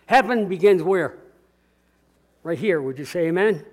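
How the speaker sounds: usual speaking voice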